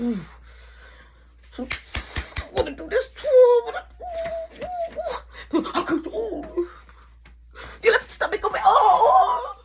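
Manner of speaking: high, giggly mock excitement; excited squeal. Girlfriend squealing over a shared plan, high and giggly. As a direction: squeal it out, laughing between words.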